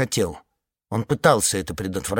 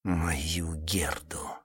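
whisper; 6 semitones lower, 8 dB quieter, much slower than usual. Acted. Whispered plea in audiobook.

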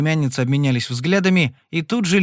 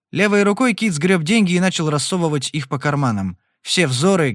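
plain reading voice

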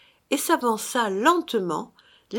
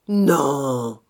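low, heavy drawn-out denial; grudging phantom; 5 semitones lower, 4 dB louder than usual. A narrator's reading. Phantom admits it to his brother, low and heavy. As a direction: answer low, as a ghost compelled.

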